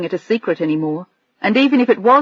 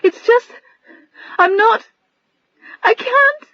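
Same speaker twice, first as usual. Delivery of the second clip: very high, halting voice choked with tears; broken sobbing; slower than usual. Acted. Adrian unable to explain to Bill, sobbing brokenly. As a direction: fall apart mid-sentence. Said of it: "She wasn't even making sense".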